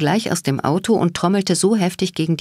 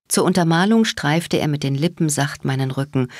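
ordinary delivery

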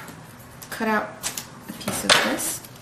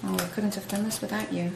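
ordinary delivery

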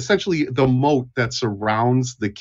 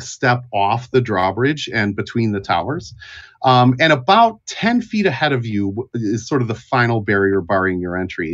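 ordinary talk, nothing marked

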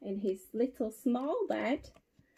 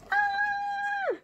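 mock scream. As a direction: squeal as the fleeing boy. Acts Eddie fleeing in fright.

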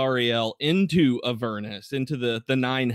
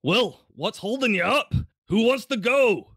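rallying paladin; 10 semitones higher, 3 dB louder than usual. Acted. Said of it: "Onthar's like".

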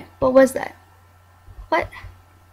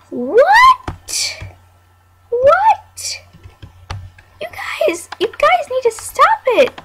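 squeaky, much higher outraged scold; high exasperated voice; 14 semitones higher, 5 dB louder, much slower than usual. Acted. Scolding the little game guests, squeaky and outraged. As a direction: pitch way up and tell them off.

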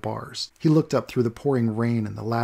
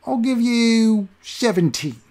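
very high, slow, louder haggle; toothless haggling beggar; 10 semitones higher, 5 dB louder, slower than usual. Acted. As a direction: lowball him like a greedy old beggar.

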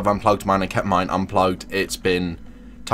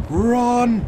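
high yell of alarm; panicked shout. Real, not acted. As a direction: shout it in sudden fright. Chased by the killer.

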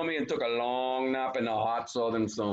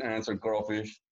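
ordinary delivery, nothing marked

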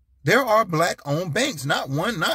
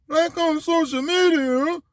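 dumb voice; 13 semitones higher than usual. Retold or imitated. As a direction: echo it back like you've never heard of it. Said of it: "That's my dumb, dumb voice".

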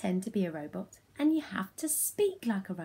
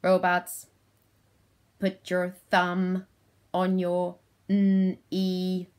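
loud, slow monotone robot; 5 dB louder, slower than usual. Shown as a demonstration. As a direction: command like a robot, splitting KNEE into n and ee. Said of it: "in my robot voice".

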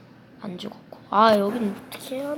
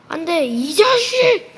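high, loud, slow dragged yell; frustrated yell; 7 semitones higher, 8 dB louder, slower than usual. Real, not acted. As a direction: shout in exasperation. Losing in game. (짜증 섞인 고함)

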